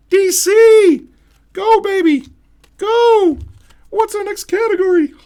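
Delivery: high squeaky cartoon mouse voice, loud; a bad, hammed-up Mickey Mouse cheering on a player; terrible Mickey Mouse. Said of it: "That's a terrible Mickey Mouse".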